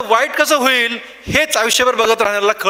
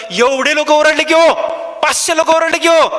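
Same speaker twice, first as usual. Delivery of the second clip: emphatic climax; 6 semitones higher, 3 dB louder than usual. Real, not acted. Driving home the lesson's climax.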